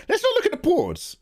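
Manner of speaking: very high squeaky aside; squeaky joke. Acted. Streamer jokes about her pores on camera, very high squeak. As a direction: squeak it out playfully.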